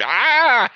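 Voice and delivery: sudden high pained yelp; loud high shriek of pain. Retold or imitated. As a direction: yelp like something just hurt. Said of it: "And I'm like".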